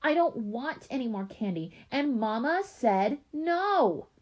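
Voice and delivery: high whine swelling to a long drawn-out NO; stubborn child outburst. A narrator's reading. Little sister's refusal escalates.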